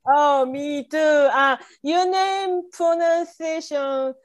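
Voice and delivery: usual speaking voice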